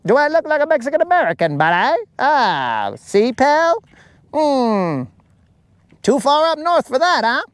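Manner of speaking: high, fast, mock-aggressive Canadian tough guy. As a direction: get up in his face, eh, buddy. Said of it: "and he might be like".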